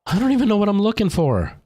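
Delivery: high, frustrated cry of confusion; exasperated, lost and rattled. Real, not acted. Frantic search in horror game.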